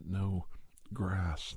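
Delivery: ordinary delivery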